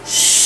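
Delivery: put-on long shh for a sleeping baby; drawn-out unvoiced shushing; hushing. Shown as a demonstration. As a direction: hush the room gently. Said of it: "The baby's sleeping".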